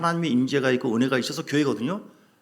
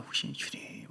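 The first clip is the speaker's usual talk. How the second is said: whispered prayer; 12 dB quieter, slower than usual. Retold or imitated.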